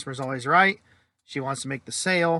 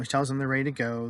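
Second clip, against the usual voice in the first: plain reading voice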